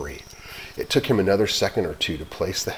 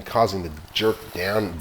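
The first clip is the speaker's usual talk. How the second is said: plain reading voice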